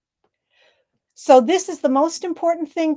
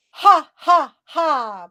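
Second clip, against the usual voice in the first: loud, higher ha ha ha; lung laugh; 4 semitones higher, 5 dB louder, much slower than usual. Shown as a demonstration. Coach leading the group in a ha ha ha laugh. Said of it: "Now the second one".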